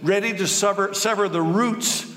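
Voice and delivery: high, stern prophet's rebuke; John the Baptist scolding the Pharisees, fierce preaching voice; John the Baptist denouncing. Acted. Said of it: "He denounced them. "You brood of snakes," he exclaimed".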